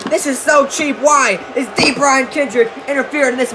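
excited ringside play-by-play; wrestling commentator. Acted. Live play-by-play of the match.

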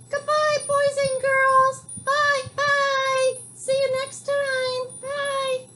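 very high, squeaky little puppet saying goodbye. Acted. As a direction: chirp it out like a tiny toy friend. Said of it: "Do you wanna say goodbye to the boys and girls?".

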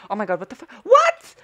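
very high, loud shriek; piercing shocked outburst; real astonishment. Real, not acted. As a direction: yelp it in total disbelief. Shocked by the game.

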